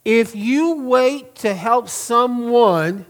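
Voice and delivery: slow, leaning hard on the "someone"; stress on one. Shown as a demonstration. Pastor slowing to press the word someone. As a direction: draw out "help someone" to land the one. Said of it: "I'm emphasizing that word one".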